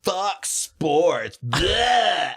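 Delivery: hammed-up scorn with a blurted noise of disgust; mock sports hater. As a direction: parody someone sneering at the whole thing. Mocks cringy sports haters.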